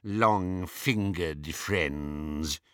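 slow, low drawling character voice; Travers, disdainful Death Eater. A narrator's reading. Death Eater deploring his company, slow and low. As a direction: draw the words out, low and slow.

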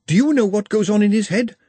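ordinary delivery, nothing marked

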